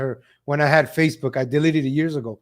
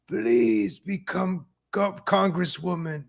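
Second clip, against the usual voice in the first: soft, slow mock deathbed voice; dying woman's plea; 7 dB quieter, slower than usual. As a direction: drag out a faint, hushed last request, imitated. Retelling a deathbed last message.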